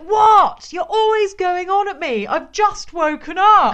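loud, high, indignant whine; stroppy child. Retold or imitated. Sulky daughter snapping at her parents, loud high whine. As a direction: whine like a grumpy kid just out of bed. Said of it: "And she was like".

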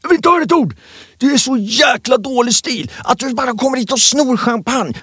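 high-pitched furious outburst at a table guest; retold furious yelling. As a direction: blast her with rage, no room to reply. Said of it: "Kicken bara säger".

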